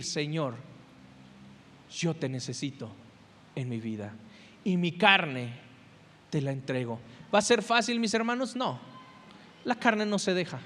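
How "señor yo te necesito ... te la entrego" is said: hushed, softer, lower surrender; soft, lowered prayer of surrender; humble prayer. Acted. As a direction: pray it quietly, handing yourself over.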